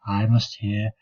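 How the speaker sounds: usual speaking voice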